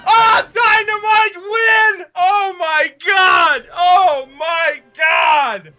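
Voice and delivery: screaming with disbelieving joy; high, loud ecstatic shouting; shrieking jackpot winner. Real, not acted. Team wins at last second.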